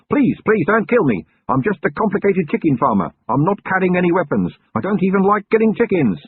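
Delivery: high, loud, pleading terror; crying out in panic. A narrator's reading. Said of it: "an indistinct face which cried".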